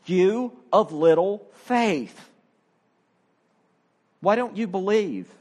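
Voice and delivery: slow, impolite upbraiding of Peter; brusque rebuke. Retold or imitated. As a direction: scold him curtly, leaning on every word. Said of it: "upbraids Peter rather impolitely and rather brusquely".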